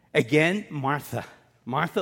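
usual speaking voice

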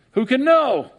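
high, loud mock shrug. Preacher mocks a court's useless question, high and loud. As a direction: toss it off like a clueless judge. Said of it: "imagine if we did that".

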